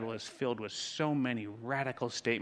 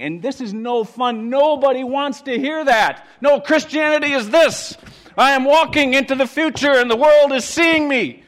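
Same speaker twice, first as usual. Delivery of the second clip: loud, high, grandiose shout; triumphant mock proclamation; 14 semitones higher, 19 dB louder than usual. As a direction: proclaim it hugely, as if to the world. Acts out triumphant, showy Christianity.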